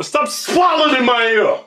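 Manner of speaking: loud, angry yell at the noise. Real, not acted. Yelling at his cohost to get out of his ear, loud. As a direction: yell it hard.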